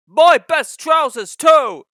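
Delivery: high, loud, slow dismayed shout. A narrator's reading. Fat controller shouting at Percy about his trousers. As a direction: wail over the ruined trousers.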